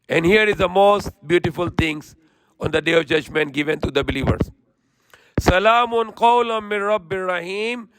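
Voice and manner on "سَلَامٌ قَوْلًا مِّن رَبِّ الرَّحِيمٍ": swift, even Quranic recitation. Acted. Preacher reciting the verse to listeners in quick chant.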